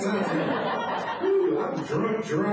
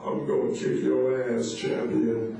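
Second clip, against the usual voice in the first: deep preacher threat; 7 semitones lower, slower than usual. Retold or imitated. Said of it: "rolled up and said".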